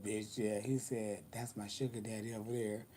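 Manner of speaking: low, hushed confiding aside; hushed quote. Retold or imitated. Friend confiding at the party, hushed and low. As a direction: murmur it like a secret.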